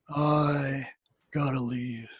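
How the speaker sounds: a hint of a blank, dazed man mumbling; zombie-like. Patient leaving the office, a mild dazed zombie mumble. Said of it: "like a zombie".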